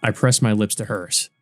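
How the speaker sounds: ordinary delivery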